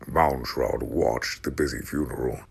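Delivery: ordinary delivery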